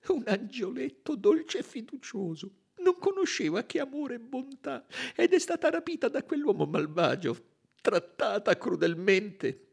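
high-pitched tearful outpouring; weeping woman. A narrator's reading. Nursemaid lamenting the murdered child, weeping. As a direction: wail through the memory, breaking into sobs. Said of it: "E pianse più forte che mai".

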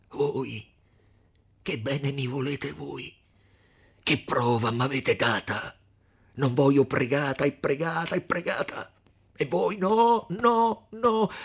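da risentito a grida alte e forti; ira crescente. Acted. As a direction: sale di rabbia fino a gridare i no. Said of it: "una voce che esprimeva un'ira". (rimprovero rabbioso che esplode in grida)

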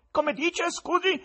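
very high, loud query; incredulous query. Acted. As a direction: ask back in a high tenor squeal.

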